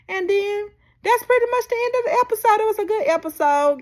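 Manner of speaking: energetic outro; upbeat sign-off. Real, not acted. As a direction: hype the episode up. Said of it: "wrapping up upbeat".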